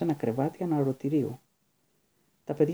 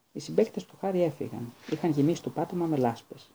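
usual speaking voice

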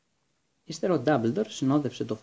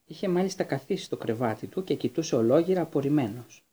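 usual speaking voice

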